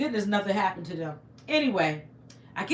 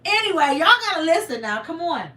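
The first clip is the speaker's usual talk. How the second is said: emphatic; 5 semitones higher, 6 dB louder than usual. Real, not acted. Calling audience back.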